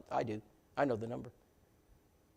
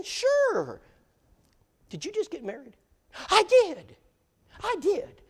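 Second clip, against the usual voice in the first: high falsetto imitation of an excited lady; mock high woman's voice; slower than usual. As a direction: squeak it out like a delighted Southern lady. Brenda answering at the door.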